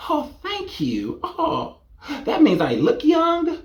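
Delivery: high, breathy mock gushing; high fluttery flattered voice; gushing flattered mom. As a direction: swoon like a flattered mother. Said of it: "And you're like".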